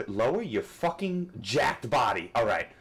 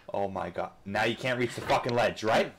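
ordinary delivery

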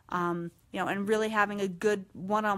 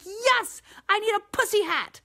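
high, louder excited agreement; gleeful shout; 11 semitones higher, 5 dB louder than usual. Retold or imitated. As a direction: yell back with joy. Calling back in agreement.

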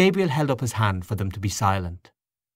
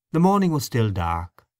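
plain reading voice

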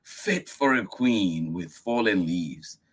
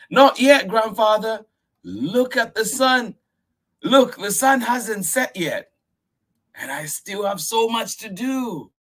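put-on very high, loud child voice, protesting; a little girl refusing bed; 6 dB louder than usual. Miyuki protesting to grandfather, high and eager. Child pleads against bedtime.

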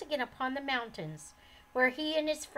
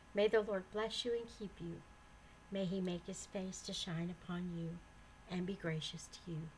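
benediction; 3 semitones lower, 7 dB quieter than usual. Acted. Reader closing the episode with a blessing, soft. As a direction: bless the listeners gently.

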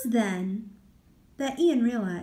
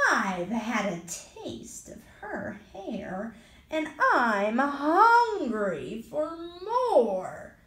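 slow, hungry monster voice; tub monster voice; 3 dB louder than usual. Acted. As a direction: drag out the hunger, menacing.

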